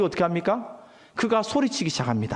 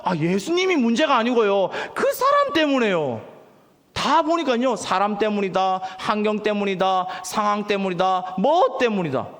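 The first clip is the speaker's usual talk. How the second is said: usual speaking voice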